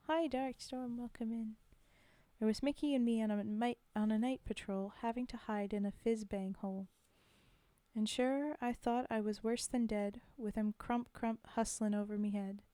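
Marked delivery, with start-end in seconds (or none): 2.4-12.6 rhythmic, steady reading of a soldier's ballad; poem recitation. Acted. Reading a war poem to the stream, rhythmic recitation. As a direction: recite it with the swing of the rhyme.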